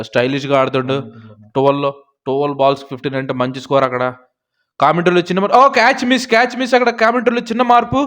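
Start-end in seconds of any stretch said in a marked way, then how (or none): 4.8-8.0 much higher, loud commentator shout; excited shout. Real, not acted. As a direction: yell about the dropped catch. A catch is dropped. (ఉత్సాహంగా గట్టిగా అరుపు)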